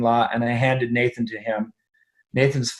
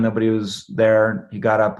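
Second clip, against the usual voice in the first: usual speaking voice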